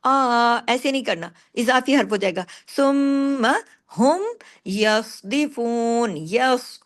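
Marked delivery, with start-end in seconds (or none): 2.8-6.1 सुम्मा हुम यस्दिफून very slow, heavy स्वाद; slow tajweed reading; slow recitation. Shown as a demonstration. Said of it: "स्वाद को हैवी करें".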